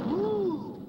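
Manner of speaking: surprised ooh. Acted. Prince startled climbing.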